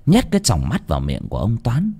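plain reading voice

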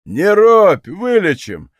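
loud mocking jeer. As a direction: taunt him with a wink. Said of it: "насмешливо сказал".

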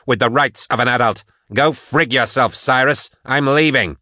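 indignant rebellion; defiant Patience. Acted. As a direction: stand your ground, building to an angry exit. Patience defies the prefect.

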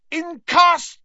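roared spell, high and loud; Harry. A narrator's reading. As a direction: roar out a sudden attack. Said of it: "Harry roared".

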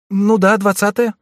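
put-on high, urgent pleading boy voice; frightened page boy. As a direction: plead anxiously like a scared young page. Clarence answers.

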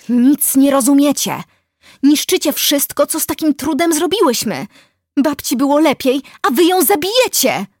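high, loud, desperate yelling; girl shouting accusations at full voice; shouted outburst. A narrator's reading. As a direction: cry out in furious protest. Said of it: "wykrzykiwała".

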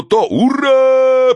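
high, long-held hurrah; drawn-out victory cry; victorious shout. A narrator's reading. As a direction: cheer triumphantly, holding the last vowel. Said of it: "победно закричали".

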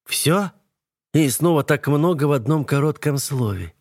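sneering, a mocking lilt. A narrator's reading. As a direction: sneer the words. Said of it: "глумливо ухмыляется Ева".

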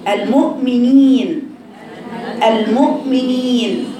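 المؤمنين slow, with a clean "neen"; correct pure i. Shown as a demonstration. Teacher repeating the correct word, long pure ee. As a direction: draw out a pure ee ending المؤمنين. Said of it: "لكن تقولي".

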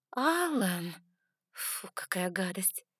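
drawn-out scolding name, then slow disgust; slow, appalled "ugh"; disgusted reproach. Acted. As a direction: recoil in mock-scandalized distaste. Heroine disgusted by crude teasing.

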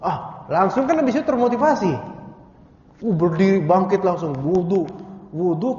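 loud, eager burst of energy; excited rush. Pretend. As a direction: jump up fired with zeal. Acting out the motivated rising. (semangat berteriak bangkit untuk wudu)

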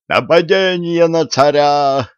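high, drawn-out cry of alarm; alarmed yell. A narrator's reading. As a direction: raise the alarm, stretching the words high. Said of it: "завопил сотник". (протяжный высокий вопль тревоги)

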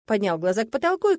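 ordinary delivery, nothing marked

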